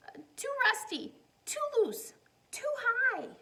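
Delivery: put-on a worried little girl at the playground, high and loud; child voice. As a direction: squeak it out like a fretful little girl.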